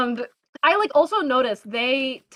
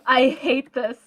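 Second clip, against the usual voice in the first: laughing; slower than usual. Real, not acted. Laughing at British accents.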